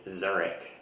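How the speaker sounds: "Zurich" ending in a hard k; k sound ending. Shown as a demonstration. Teacher showing the English way, "Zurich" with k. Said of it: "with a k sound instead of the sh".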